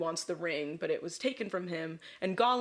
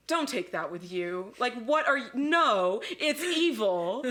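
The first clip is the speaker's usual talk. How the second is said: exasperated mock scolding, louder and higher; urgent warning; 4 semitones higher, 4 dB louder, slower than usual. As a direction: plead against it, drawing out the key words. Acts out Gandalf warning Bilbo.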